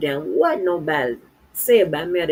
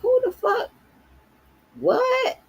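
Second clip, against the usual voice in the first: much higher, stunned who the fuck; shocked outburst; slower than usual. Real, not acted. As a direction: jolt up in real shock. Shocked reaction to the story.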